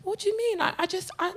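high, soft, wide-eyed protest; non-confrontational innocence. Retold or imitated. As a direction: play innocent in a small, high voice. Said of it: "It was that kind of attitude".

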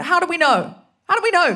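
high and loud, urgent; panicked. Retold or imitated. Comedian panicking at her conscience, loud and high. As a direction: fire the question in alarm. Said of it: "I went".

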